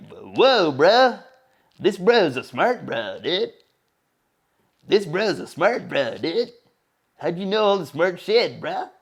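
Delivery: slow, put-on dude-bro amazement; awestruck bro. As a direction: play a slow-witted bro having his mind blown. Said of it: "if you're a bruh".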